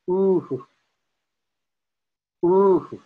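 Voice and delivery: "ooh" with a breathed "hu" echo after it; echo at the lips. Shown as a demonstration. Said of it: "at the lips".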